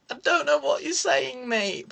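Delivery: high, innocent playing dumb; mock clueless. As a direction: pretend you don't get a word. Pretending not to understand driver.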